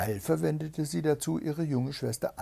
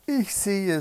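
raised, high lookout call; excited calling; 8 semitones higher, 6 dB louder than usual. A narrator's reading. Sister answers from above, calling out. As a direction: announce the sighting from the tower. Sister calls sighting from tower.

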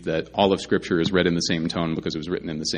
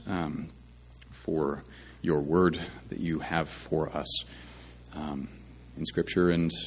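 prayer; 3 semitones lower than usual. Real, not acted. Opening prayer before the sermon.

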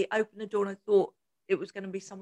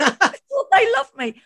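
higher, louder, slower, hurt and baffled; a hammed-up baffled date insisting he's loved; mock bewildered protest; 8 semitones higher, 7 dB louder, slower than usual. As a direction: play the date's wounded disbelief.

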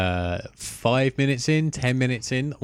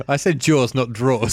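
ordinary talk, nothing marked

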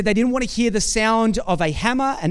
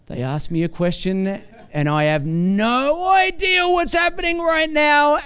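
mock panic; 5 semitones higher than usual. Congregant asked a question they never heard, mock panicked cry. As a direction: sell the panic of being caught out, half joking.

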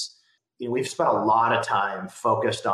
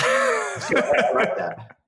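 laughing; much slower than usual. Real, not acted. Laughing at the buzzword intro.

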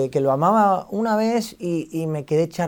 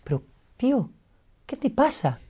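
high, softer imitation of Ibai's concern; pitched-up, quiet worried question, imitating a friend; 7 semitones higher, 8 dB quieter than usual. Friend asks shaken streamer what is wrong, imitated worry. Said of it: "Ibai, preocupado, me dice".

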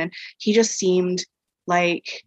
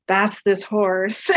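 slow realization; much slower than usual. Retold or imitated. Realizing it is the same horse.

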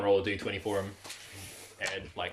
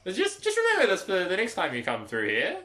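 raised, high creature voice; hungry female creature; 9 semitones higher than usual. Acted. Creature reminding a traveller for next time, high-voiced. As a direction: pitch it up as a hopeful creature. Game master plays a creature.

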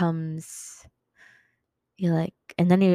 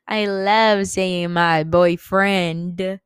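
very slow, stretched-out words; savouring; 3 dB louder, much slower than usual. Pretend. Telling listeners she loves the word boyfriend, drawn out. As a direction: stretch every word, relishing it.

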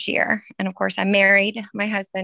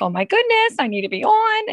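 imitating an anxious child, high and louder; mock freaking out; 13 semitones higher, 4 dB louder than usual. Mother imitating her daughter freaking out over lost internet, mock panic. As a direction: act out a panicky daughter, pitched way up. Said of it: "she starts freaking out".

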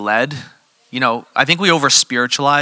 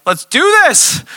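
eager yes; 5 dB louder than usual. Retold or imitated. Woman eager to pray.

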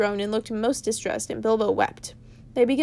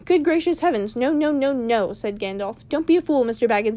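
exasperated Gandalf; 5 dB louder than usual. Acted. Gandalf to Bilbo, exasperated loud scolding. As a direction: scold the fool loudly.

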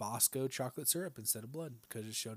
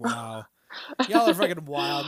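mock disbelief; 2 semitones higher, 10 dB louder than usual. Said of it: "I'm like".

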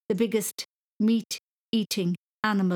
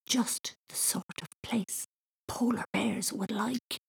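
soft, slow whisper; 4 semitones higher, 5 dB quieter, slower than usual. A narrator's reading. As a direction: keep it hushed and dreamy. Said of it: "Thomas whispered".

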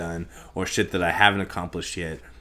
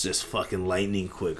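ordinary talk

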